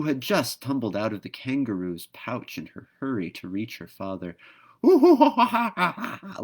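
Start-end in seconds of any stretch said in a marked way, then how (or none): 4.8-6.4 high, loud hooting cackle; whooping bird laughter; kookaburra laugh. A narrator's reading. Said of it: "laughed a kookaburra".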